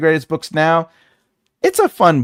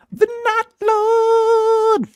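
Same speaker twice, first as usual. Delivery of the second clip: slow, very high mock proclamation; hammed-up lofty herald voice; grand title; much slower than usual. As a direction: announce it like a herald, drawn out. Joke title Not Lord.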